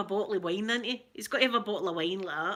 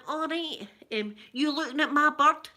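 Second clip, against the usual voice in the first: higher, confrontational Ned voice; wee man; 6 semitones higher, 4 dB louder than usual. Acted. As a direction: act out a wee Ned picking a fight. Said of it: "Yeah, the wee man".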